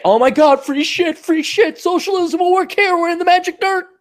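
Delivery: high, whiny mock of a leftist begging for free stuff. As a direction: sneer it in a high put-on voice. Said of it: "they may not give you free shit".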